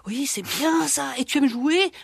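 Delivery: slow, honeyed coaxing voice; sugary voice. Acted. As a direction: draw her in, slow and sweet. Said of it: "lui parle avec sa voix doucereuse".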